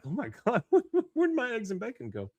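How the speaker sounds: high-pitched mock dismay; Bobby, bewildered. Boy asks mother about his trashed breakfast, in comic dismay. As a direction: squeal it like a boy robbed of breakfast.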